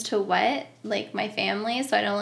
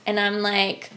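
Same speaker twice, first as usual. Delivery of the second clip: ordinary talk, nothing marked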